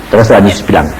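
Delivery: ordinary talk, nothing marked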